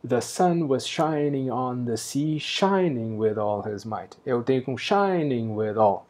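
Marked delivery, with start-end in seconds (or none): 0.1-4.2 slow, "sun" in "The sun was shining on the sea" rising, each beat marked; slow scansion reading. Shown as a demonstration. Teacher reading both lines aloud to show the stumble, slow. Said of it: "Se eu leio". 4.8-6.0 slow, "shining" in "shining with all" stressed, "with all" left weak; stress gap shown. Shown as a demonstration. Teacher pointing out the unstressed gap, slow. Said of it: "todo esse buracão sem acento".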